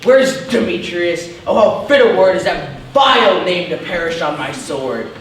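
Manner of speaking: high, loud, ardent outburst; grand, overblown lover's fervour; impassioned declaration. Acted. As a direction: leap awake madly in love. Lysander wakes love-struck, boasts.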